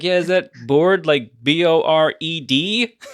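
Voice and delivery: loud joke punchline, spelling slowed at the end; acted comeback. Acting out Norm's big joke.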